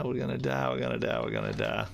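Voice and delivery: mock doom, sung low; deep doom voice. Streamer mocking his own doom, deep and low. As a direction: drop the pitch way down.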